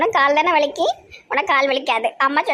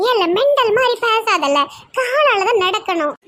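scolding high-voiced figure; 9 semitones higher, slower than usual. Scolds child for silly idea.

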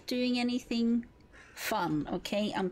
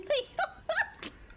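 high giggle. Real, not acted. Laughing at incoming.

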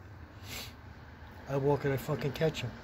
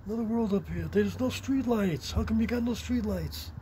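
mocking quote; 7 semitones higher than usual. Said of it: "he used to".

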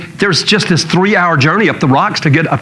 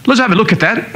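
higher-pitched imitation of a British doctor; confident British bedside manner, imitated; put-on British doctor voice; British accent; 5 semitones higher than usual. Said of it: "He's British".

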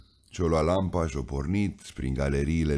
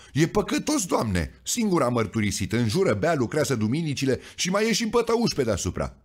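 high, quick, eager tattle; a saint telling tales, high and fast; tattling accuser; 8 semitones higher than usual. A narrator's reading. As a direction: rush to tell on him. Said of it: "sări îndată cu pâra".

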